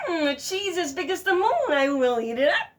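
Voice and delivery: high, loud eager relish; greedy fox. Acted. Fox eyeing the moon reflection, greedy and eager. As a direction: lick your lips over it.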